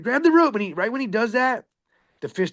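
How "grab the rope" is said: high, loud call to hang on; shouted order. Retold or imitated. Captain shouting to his buddy mid-fight, loud. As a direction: yell it across the deck. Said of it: "I was like".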